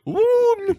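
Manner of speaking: high squealed mock ooh; hammed-up delighted coo. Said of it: "That mute bitch is like".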